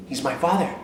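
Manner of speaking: ordinary talk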